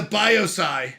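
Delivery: "biopsy" said as BYE-oh-sigh; mock mispronunciation. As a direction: make "biopsy" end in "sigh", with a long O. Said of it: "How does King pronounce biopsy?".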